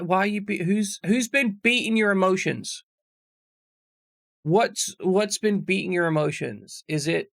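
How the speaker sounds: ordinary talk, nothing marked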